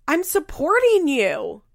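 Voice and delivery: high, loud, drawn-out mocking retort; stretched-out imitation of indignation; mock indignant. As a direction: whine it loudly, mocking his protest. Mocks Javi's parting retort.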